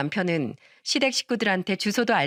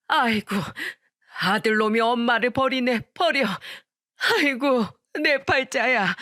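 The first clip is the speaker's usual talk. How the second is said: wailing lament; 3 semitones higher than usual. A narrator's reading. Mother-in-law locked out, lamenting her son abandoning her, wailing. Mother wails at being thrown out.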